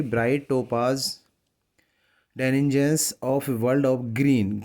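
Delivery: drawn-out reading of a poem line; slow poem recitation. Shown as a demonstration. Teacher reading a poem line to students, slow recitation. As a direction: read each word very slowly for the class.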